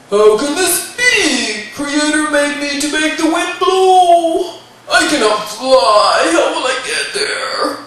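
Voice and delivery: loud, slow, booming protest; a huge creature puzzling aloud; bewildered giant. Acted. Giant Wendigo protests he cannot fly.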